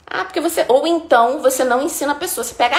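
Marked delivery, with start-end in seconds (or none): none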